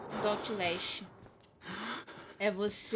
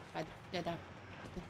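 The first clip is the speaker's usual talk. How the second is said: soft muttered curse; cursing under breath; 5 semitones lower, 10 dB quieter than usual. Real, not acted. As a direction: grumble it quietly. Muttered curse at game villain.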